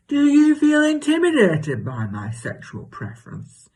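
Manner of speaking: high mock-earnest challenge, easing down at the end; imitated earnest neighbour. Neighbour challenging her about feeling intimidated, mock-earnest. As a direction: put on the neighbour's pointed, high-pitched voice.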